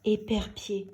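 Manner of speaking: ordinary delivery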